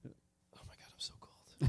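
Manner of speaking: voiceless whisper, freezing; hushed, breathy complaint about cold; whispered suffering. Acted. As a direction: whisper it as if frozen through.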